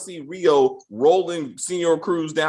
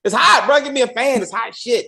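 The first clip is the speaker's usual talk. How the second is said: high, raised-voice mock griping; mock overheated complaint; 7 semitones higher, 5 dB louder than usual. Host playing a man sweltering in a suit, high mock complaint. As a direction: complain up high about the heat.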